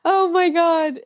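high, stunned gasp of disbelief; shocked. Real, not acted. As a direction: react like you can't believe it. Shocked reaction to story.

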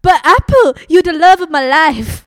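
loud, high, gushing declaration; melodramatic lover. Acted. Apple crying out her love to Pine, loud and high. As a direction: belt it like a soap-opera lover.

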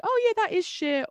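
sudden realization. Retold or imitated.

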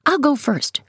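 high, eager announcement; announcing child. A narrator's reading. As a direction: announce it brightly at a high pitch. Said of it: "he announces".

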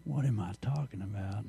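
muttered aside. Real, not acted. Preacher checks himself after a joke, quiet aside. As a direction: drop to a low, quiet aside.

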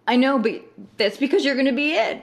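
excited. Retold or imitated. Insisting excitedly.

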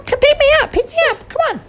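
very high squeaky pleading; tiny mouse calling its friends. Acted.

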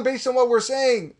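ordinary delivery

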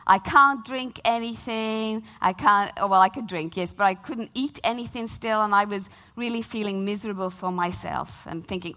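ordinary talk, nothing marked

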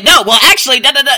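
loud, high-pitched talk-over; mock interruption. Mimics friends jumping in over her.

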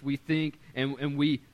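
ordinary talk

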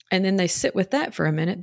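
usual speaking voice

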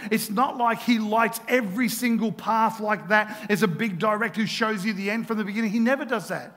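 ordinary talk, nothing marked

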